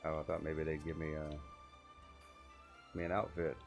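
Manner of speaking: normal talking voice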